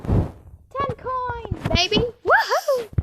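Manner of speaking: loud happy whoop; excited whoop. Real, not acted. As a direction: cheer it out loud. Celebrating coins.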